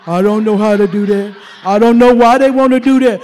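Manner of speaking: low, grumbling excuses; mock complaining goat. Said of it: "billy goats ... saying".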